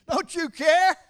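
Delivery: high, loud, whiny complaint; a hammed-up frantic shout of "don't you care"; mock indignant cry. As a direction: cry it out like a frightened disciple scolding a friend. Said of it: "That's how a friend talks to a friend".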